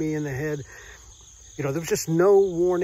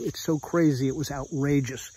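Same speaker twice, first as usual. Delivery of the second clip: ordinary talk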